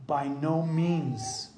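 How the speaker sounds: slow, weighty "By no means."; emphatic. Retold or imitated. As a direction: lean hard and slow on "By no means.". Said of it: "And he says emphatically".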